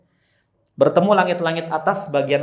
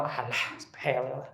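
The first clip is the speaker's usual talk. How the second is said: dismissive; 2 semitones lower, 10 dB quieter than usual. Retold or imitated. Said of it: "Bagi sebagian orang".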